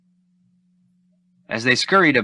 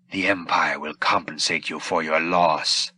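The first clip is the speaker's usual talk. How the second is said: succinct Vader; 3 semitones lower than usual. Acted. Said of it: "Vader said succinctly".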